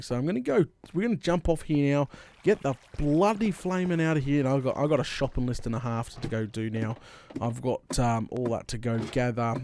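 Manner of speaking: ordinary talk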